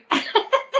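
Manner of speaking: high delighted laugh; laughing at her own story; bright burst of laughter. Real, not acted.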